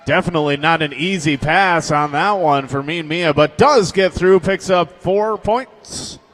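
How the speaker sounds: loud, fast derby commentary; play-by-play. Acted. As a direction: call the jam as it happens. Live play-by-play.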